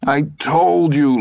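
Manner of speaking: high, drawn-out distraught reproach; babbling. A narrator's reading. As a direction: wail it at him accusingly. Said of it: "Lydia babbled".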